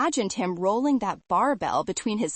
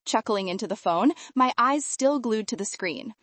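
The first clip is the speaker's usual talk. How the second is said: ordinary delivery, nothing marked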